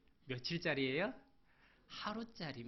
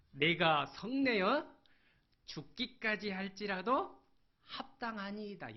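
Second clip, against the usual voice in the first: loud, slow, high angry answer; defiant retort; 6 semitones higher, 5 dB louder than usual. Acted. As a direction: throw it back at God. Preacher voices defiant Jonah. (하나님께 크게 대드는 요나)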